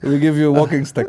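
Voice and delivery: high, loud character voice while laughing; hammed-up broken-English invitation; mock café elder. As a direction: make the offer as a comic foreign local. Continues café men's voice.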